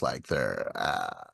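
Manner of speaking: low Californian creak; vocal fry. Shown as a demonstration. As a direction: let the voice sink into a fried croak. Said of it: "sounds like their vocal cords are fried".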